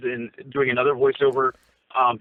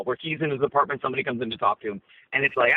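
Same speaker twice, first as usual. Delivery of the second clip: usual speaking voice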